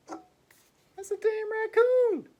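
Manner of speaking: high, slow, stretched exclamation; mock outrage. Real, not acted. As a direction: drag out the disbelief, way up high. Retorting to Spider-Man comparison.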